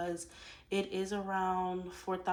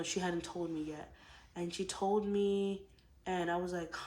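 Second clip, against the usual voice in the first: normal talking voice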